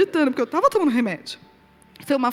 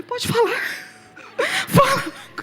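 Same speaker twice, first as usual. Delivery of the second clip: nervous laugh; 13 semitones higher, slower than usual. Retold or imitated.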